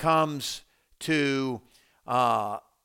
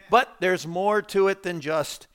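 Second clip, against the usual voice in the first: normal talking voice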